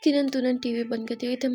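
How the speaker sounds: plain reading voice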